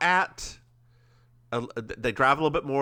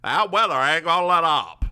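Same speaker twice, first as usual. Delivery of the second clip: put-on higher, loud old-man warning; 5 semitones higher, 4 dB louder than usual. The old man keeps warning about the storm, hammed-up and loud. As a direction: play the cranky old man going on about weather. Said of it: "the old man's being the old man".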